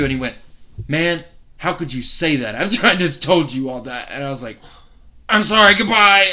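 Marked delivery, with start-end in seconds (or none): 0.9-4.1 retold indignant protest. Acts out the hurt comedian. 5.3-6.3 high, loud mock-panicked blurt; awkward hasty exit. Comic re-enacts fleeing after a blunder, loud awkward blurt. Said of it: "left in the most awkward way".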